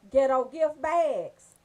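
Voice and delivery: ordinary delivery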